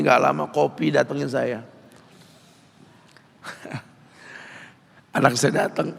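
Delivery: ordinary delivery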